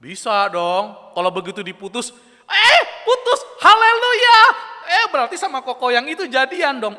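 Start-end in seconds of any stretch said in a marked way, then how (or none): 2.5-7.0 high, loud, gleeful squeal; hammed-up joyful girl voice; mock delight. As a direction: celebrate the breakup like good news. Dumped girl rejoices mockingly.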